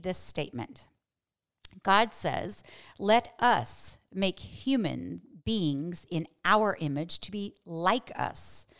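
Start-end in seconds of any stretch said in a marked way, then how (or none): 3.0-8.7 slow reading, leaning on "us" and "our"; stress on US and OUR. Shown as a demonstration. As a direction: read it slowly, setting off "us" and "our" with clear emphasis. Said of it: "I tried to emphasize the word us and our".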